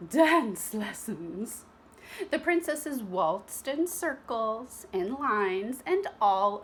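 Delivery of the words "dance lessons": very slow drawn-out announcement; grand announcement. Acted. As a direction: savour each word. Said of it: "said Madame Gertrude".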